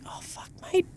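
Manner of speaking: whispered under the breath; a whispered private groan at the TV; muttered aside. Retold or imitated. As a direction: whisper it to yourself, exasperated. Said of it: "And I'm like".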